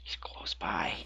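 hushed whisper so it won't hear; sneaky whisper. Real, not acted. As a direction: whisper it tensely. Sensing a nearby enemy.

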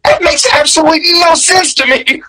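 put-on high, incredulous inner voice of a kid; a kid who thinks it's nonsense; baffled disbelief. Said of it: "And I'm thinking".

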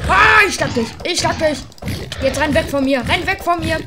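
loud, high fight yells; real gamer fight cry; battle shouting. Real, not acted. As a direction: shout at the mob. Fighting mob again.

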